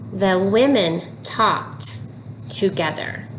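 slow, even reading, each word clear; slow model reading. Shown as a demonstration. Teacher models the sentence slowly for children. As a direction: say it slowly so they can follow. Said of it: "Read with me".

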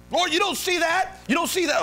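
high, incredulous protest; hammed-up high-pitched accusation; exasperated accuser. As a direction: play an indignant Satan, pitch shooting up. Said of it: "Satan, the accuser of the brethren".

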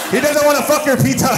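high, loud comic shout; shouted joke. Real, not acted. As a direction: yell the punchline at the crowd. Yelling joke at audience.

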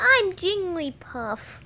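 high, slow, cutesy squeak; sweet little creature voice; Jigglypuff. Pretend. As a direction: draw it out in a tiny high voice. Repeats joke character voice.

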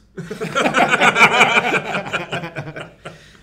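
long, high, loud belly laugh; loud laughter. Real, not acted. Laughing hard at the studio banter, loud laughter.

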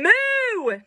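high, swooping long moo; pig's moo. Acted. The pig that went moo, high drawn-out moo. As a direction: moo way up high, then fall away.

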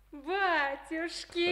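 gasp, then a high joyful cry of recognition; delighted surprise. Acted. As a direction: gasp as if you can't believe who's here. Woman surprised to see old friend. (радостное изумление, ахнуть и вскрикнуть)

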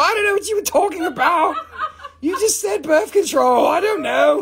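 barely getting words out for laughing; laughing so hard the voice rises high. Real, not acted. Cracking up over arm implant.

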